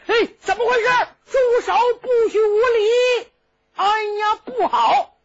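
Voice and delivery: high, loud stop-it call; commanding shout. Acted. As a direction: bark at the boys to stop. Cheng Yaojin arrives shouting.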